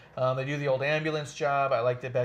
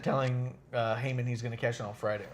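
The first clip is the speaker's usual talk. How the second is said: ordinary talk, nothing marked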